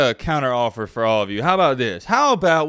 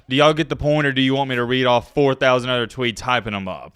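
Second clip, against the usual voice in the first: ordinary talk, nothing marked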